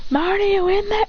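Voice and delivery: high sing-out call; high, teasing knock-knock call. Acted. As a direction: call playfully high to someone inside. Pounding on barrier, calling out.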